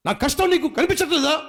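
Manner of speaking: high-pitched mock complaint; mock prayer shouting. Preacher mimics someone shouting troubles at God, high mock yell. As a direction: yell it high, as though God were deaf. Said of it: "అరుపులే అరుపులు, కేకలే కేకలు".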